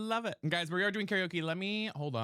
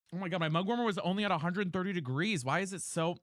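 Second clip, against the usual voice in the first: ordinary talk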